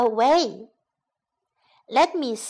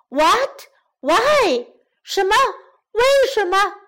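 loud, high cries of disbelief; shocked lion; 8 semitones higher, 6 dB louder than usual. Acted. Lion rejected by the girl, loud and shocked. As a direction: blurt it out in dismay.

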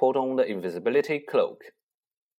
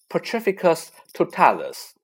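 cried out; 5 semitones higher, 4 dB louder than usual. Acted. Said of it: "she cried".

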